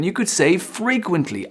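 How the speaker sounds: normal talking voice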